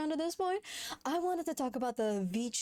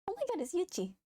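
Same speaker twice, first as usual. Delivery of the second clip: excited; 8 semitones higher, much faster than usual. Real, not acted. Excited at the art.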